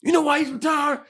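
high, shouted, slowing rant; angry rant. Retold or imitated. Old Alabama fan ranting about paid players, shouting high. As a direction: rant at the top of your voice, dragging it out. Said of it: "he just goes on this rant".

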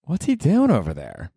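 a neighbor wondering through the wall; imagined neighbor. Retold or imitated. Host imagining his neighbors wondering about the new guy, mildly mocking. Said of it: "I wonder if my neighbors are like".

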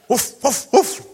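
high, loud imitated dog bark. Dog answers butcher in joke.

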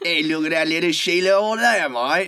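Aussie veering into Cockney; hammed-up, muddled Australian-Cockney accent; bad Australian accent. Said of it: "That's like Cockney and Australian".

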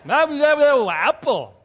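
loud, high imitated babble; toddler speaking tongues. Preacher imitates his daughter speaking in tongues, loud toddler babble. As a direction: mimic a toddler babbling away with gusto. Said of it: "speaking in tongues, literally".

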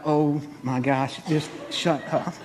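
low, slow, hushed scolding; exasperated wife. Retold or imitated. Wife to her noisy husband mid-massage, exasperated hush. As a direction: shush him wearily under your breath.

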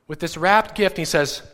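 usual speaking voice

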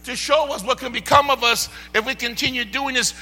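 shouted revival preaching; full-force preaching. Acted. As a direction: preach it hard to the congregation.

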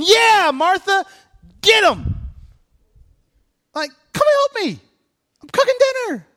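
high, loud hype voice; rally her like a fan; cheering Martha on. Retold or imitated. Pastor voicing himself egging Martha on, high and loud.

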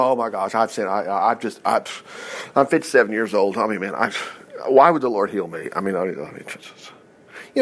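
low, soft mock self-pity, lightly voiced; mock doubter. Preacher mimics a believer doubting healing, low and soft. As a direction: put on a small doubting believer's voice.

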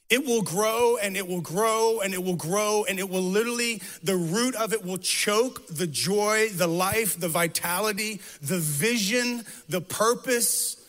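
rising, driving sermon over music; preaching. Acted. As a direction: build the sermon's fervor line by line.

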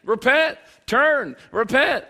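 loud, high calls to repent; urgent preaching call. Retold or imitated. Mimicking John the Baptist's one sermon to the crowd, loud and urgent. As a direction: punch out each command, high and loud. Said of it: "his message was repent".

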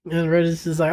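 normal talking voice

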